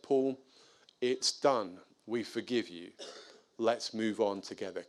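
low, soft reassurance; hushed, sincere retold reassurance; gentle forgiveness. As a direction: forgive him quietly, leaving space between phrases. Forgiving the neighbour.

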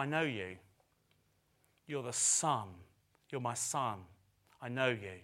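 put-on soft, low, gentle words as God to his child; tender voice of God. Preacher voicing God to the congregation, softly and tenderly. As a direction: speak softly as a loving father.

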